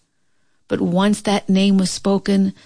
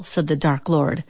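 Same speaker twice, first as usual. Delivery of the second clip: ordinary delivery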